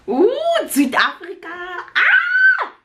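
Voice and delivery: high excited squeal. Real, not acted. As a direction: squeal with delight at the page. Spots South Africa page.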